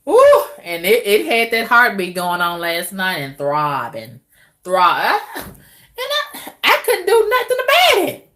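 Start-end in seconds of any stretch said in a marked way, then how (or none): 6.0-8.3 high, helpless protest; helpless squeal. Real, not acted. Woman telling viewers she couldn't stop the throbbing, squealing high. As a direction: throw your hands up, voice way up.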